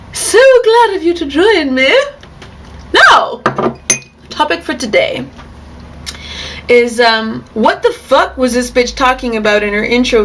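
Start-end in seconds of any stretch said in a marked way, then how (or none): 0.0-8.9 breathy vintage purr, high and loud; old-time talk show hostess voice; Missus Washington. Acted. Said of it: "Welcome back to Tea Time with Missus Washington".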